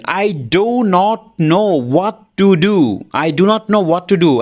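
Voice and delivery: every word of "I do not know what to do" spaced out slowly. Shown as a demonstration. Teacher showing the stiff careful way: slow separate words. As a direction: spell it out like a textbook. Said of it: "class ফোরের বাচ্চা এইভাবে বলবে না".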